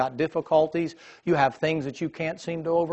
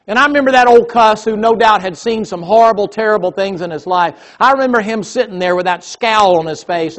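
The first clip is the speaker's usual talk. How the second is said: louder, higher revival preaching; fiery preaching; 4 semitones higher, 7 dB louder than usual. Acted. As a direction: build the sermon with fire, louder. Preaching at full force.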